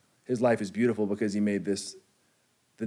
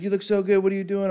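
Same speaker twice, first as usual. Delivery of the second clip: high, sugary mock gush; gushing fake-nice; 9 semitones higher, faster than usual. Imitating an old acquaintance's high, gushing hello. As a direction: overdo the sweetness in her greeting. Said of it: "she's like".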